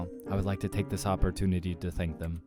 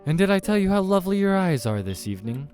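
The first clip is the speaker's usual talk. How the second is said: high, loud gushing compliments starting big; gushing date, then a raised reply; effusive flatterer; 5 semitones higher, 6 dB louder than usual. Acted. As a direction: lay on the charm, pitched up. Man flatters the demon.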